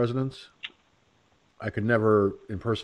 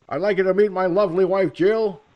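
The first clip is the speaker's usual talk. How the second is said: higher, louder and quick presidential intro; Biden impression; 8 semitones higher, 7 dB louder than usual. Vlogger mocking Biden introducing his sister as wife. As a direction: proudly present her to the crowd. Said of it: "Joe Biden's an easy one".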